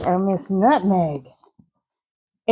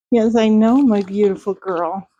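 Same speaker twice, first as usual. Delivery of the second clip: usual speaking voice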